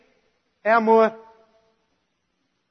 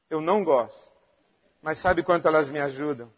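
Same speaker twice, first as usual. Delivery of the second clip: much softer and lower; low, soft rhetorical question; quiet; 9 semitones lower, 9 dB quieter than usual. Real, not acted. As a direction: draw them in with a hush. Rhetorical question, then quieter repeat.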